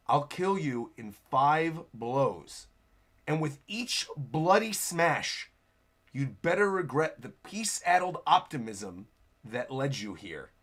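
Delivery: low, slow, measured threat; menacing villain. Acted. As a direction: deliver a villain's threat slowly and softly. Acting a villain's death threat.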